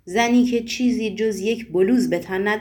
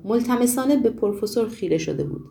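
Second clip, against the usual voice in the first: usual speaking voice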